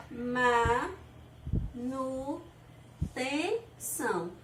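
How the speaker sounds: Manutenção broken into ma-nu-ten-ção, each syllable detached and very slow; split into syllables. Shown as a demonstration. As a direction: spell out Manutenção syllable by syllable for the class. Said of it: "nós podemos dividir ela assim, ó". (separando as sílabas: ma-nu-ten-ção, bem devagar)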